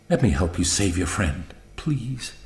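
low, hushed entreaty; soft plea. A narrator's reading. Betty meets his eyes, pleading quietly. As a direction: ask softly, fading on the last word. Betty begs to come along.

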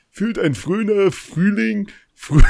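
grandpa voice. Retold or imitated. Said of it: "Und mein Opa meinte mal".

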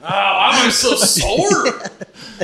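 slow, high, loud mock moan. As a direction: ham up a breathless moan. Mock moaning like a sex toy.

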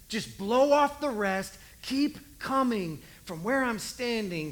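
loud, stirring exhortation; fervent encouragement. Acted. Jesus calls to struggling believers, fervent encouragement. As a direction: urge them on with fire.

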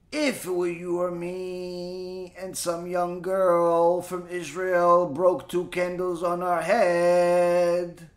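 very slow, level monotone; slow drawn-out musing. Acted. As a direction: stretch each word out on one held pitch. Slow hypothetical aside after the punchline.

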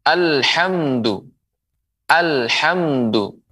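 slow, careful "alhamdu"; slow model reading. Shown as a demonstration. Teacher modelling "alhamdu" slowly to viewers. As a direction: sound out "alhamdu" for students.

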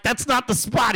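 usual speaking voice

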